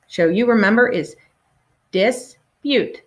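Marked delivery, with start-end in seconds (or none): 1.9-3.0 dispute split into dis, pause, pute; syllable by syllable. Shown as a demonstration. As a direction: model "dispute" in two separate beats. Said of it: "Let's say it together".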